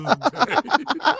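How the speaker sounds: punchline breaking into loud laughter; cracking up. Real, not acted. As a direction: lose it laughing as you read.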